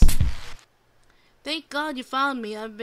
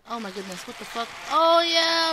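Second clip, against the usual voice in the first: normal talking voice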